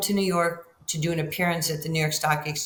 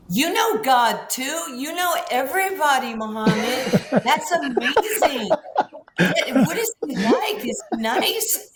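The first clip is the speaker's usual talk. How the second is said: high, loud, laughing gush; a starstruck young girl gushing at her hero; giddy excitement; 8 semitones higher, 5 dB louder than usual. Retold or imitated. Excited young girl asking Ali.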